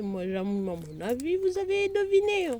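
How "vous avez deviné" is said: much higher and louder singsong guessing game; playful teasing. Real, not acted. Vlogger urging viewers to guess, high playful singsong. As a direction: tease them like a quiz host.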